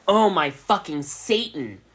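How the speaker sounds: loud, high, drawn-out shriek; scream. Acted. Ebony shocked at the elf, a loud slow scream. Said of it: "she screamed".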